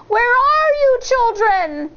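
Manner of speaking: put-on high, loud call for the children; the wolf calls up the tree, a high loud shout; wolf's shout. A narrator's reading. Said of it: "the wolf shouted".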